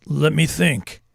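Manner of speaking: a hint of a miner voice; lightly voiced miner. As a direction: play the miner musing. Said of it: "That's my minor voice".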